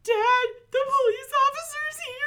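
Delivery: high, loud, teary cry for help; panicked call to dad. Retold or imitated. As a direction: yelp it like a scared kid.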